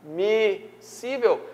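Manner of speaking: Admissível drawn out slowly syllable by syllable; slow syllabification. Shown as a demonstration. Teacher spelling out the word for students: Admissível, slow and separated. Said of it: "se você fazer só ... a soletração".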